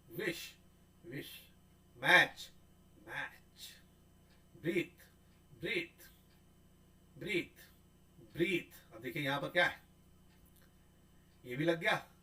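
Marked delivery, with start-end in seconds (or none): none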